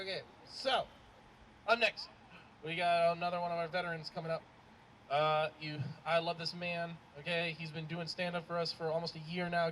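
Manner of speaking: normal talking voice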